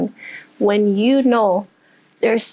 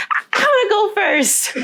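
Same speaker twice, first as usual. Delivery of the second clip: much higher, bubbling with eagerness; eager volunteering; 10 semitones higher than usual. Retold or imitated. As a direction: jump at the chance to go first.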